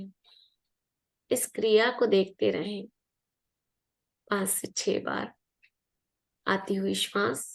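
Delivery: unhurried meditation guide, long pauses between phrases; guided meditation. Real, not acted. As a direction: guide listeners gently into relaxation, leaving room to breathe.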